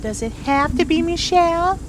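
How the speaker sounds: high, whiny mimic of the partner; snide impression of the partner; mocking Wilder. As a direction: parrot him back with a sneer. Mocking partner's earlier line.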